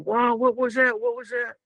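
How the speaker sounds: high-pitched, startled questioning; imitated alarmed crowd. Everybody reacting to the stomping, high mock-alarmed voice.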